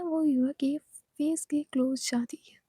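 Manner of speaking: usual speaking voice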